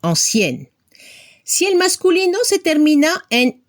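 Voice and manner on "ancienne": ancienne ending on a clearly heard N; low French ancienne, falling onto a full N; final N sounded. Shown as a demonstration. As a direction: let the N ring at the end of ancienne. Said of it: "se escucha la N final".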